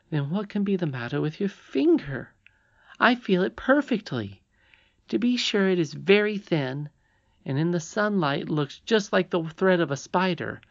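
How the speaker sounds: high princess voice, explaining eagerly; insistent young princess. Acted. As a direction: explain it earnestly as the little princess. Irene explains the thread.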